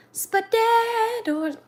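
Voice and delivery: high, drawn-out soothing coo; mock soothing. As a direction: stretch it out high, pretending to soothe. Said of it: "Is this soothing to you?".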